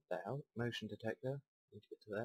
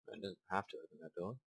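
ordinary talk, nothing marked

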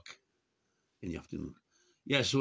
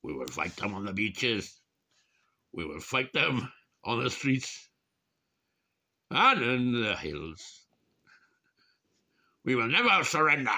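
wartime speech impression; 3 semitones higher, 4 dB louder than usual. Doing a wartime leader's speech to the camera, rising to rousing. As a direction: rouse the nation, each line bigger than the last. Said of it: "I talk a bit like that, don't I?".

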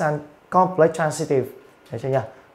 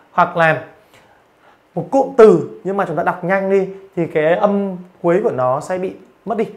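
normal talking voice

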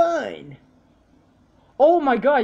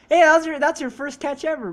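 loud, higher-pitched burst on "Hey"; excited exclamation; 5 semitones higher, 7 dB louder than usual. Real, not acted. Player hailing a friend's first catch ever, excited exclamation. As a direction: burst in loudly, then ease off.

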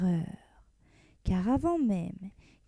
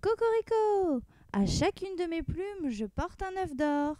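rooster crowing from afar; 6 semitones higher than usual. Acted. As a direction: crow proudly coming home. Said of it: "on l'entendit crier".

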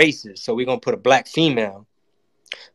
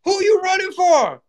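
much higher, stunned disbelief; incredulous; slower than usual. Retold or imitated. Comic reacting in high disbelief to Biden's tape. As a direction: shriek it in mock shock. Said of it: "I'm like".

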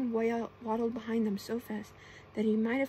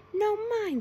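high, loud, insistent claim; loud imp; 11 semitones higher, 7 dB louder than usual. A narrator's reading. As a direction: shout over the others for your turn. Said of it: "said the imp loudly".

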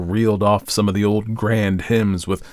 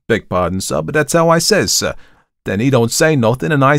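louder, higher Cockney storyteller; Thomas; 7 semitones higher, 5 dB louder than usual. A narrator's reading. Thomas recounts meeting the watchman, in lively Cockney. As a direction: tell the tale in animated Cockney. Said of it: "had not only modified Thomas's Cockney dialect".

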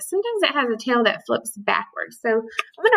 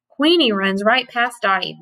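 normal talking voice

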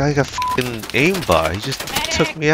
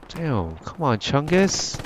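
ordinary talk, nothing marked

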